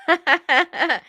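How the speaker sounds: open, amused burst of laughter; real laugh. Real, not acted. Laughing at how he looks at her, amused laugh. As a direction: laugh out loud at what you see. Laughs at [name]'s look on screen.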